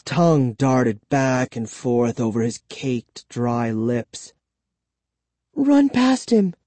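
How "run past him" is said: plain reading voice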